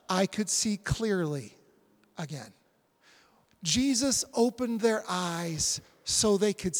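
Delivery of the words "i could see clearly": usual speaking voice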